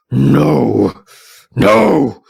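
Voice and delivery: loud, rising cries of no; desperate shout. Acted. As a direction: yell it out as the vision breaks. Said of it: "hear his voice again go".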